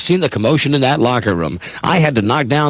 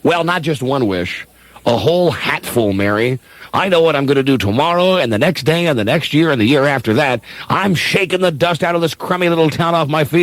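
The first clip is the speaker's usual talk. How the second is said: ordinary talk, nothing marked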